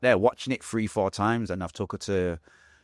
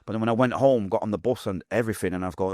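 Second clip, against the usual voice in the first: ordinary talk, nothing marked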